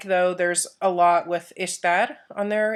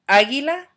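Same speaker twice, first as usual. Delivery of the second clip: águila with the first A hit higher and louder; stress on first syllable; 6 semitones higher, 5 dB louder than usual. Shown as a demonstration. Teacher modelling for students, águila stressed on first A. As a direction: punch the opening A of águila. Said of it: "putting the accent on the, that first A".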